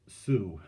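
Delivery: Sue, silent l and t; shown pronunciation. Shown as a demonstration. Teacher explains how Sault is said, slowly as Sue. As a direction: say Sue slowly as one open syllable. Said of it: "is pronounced".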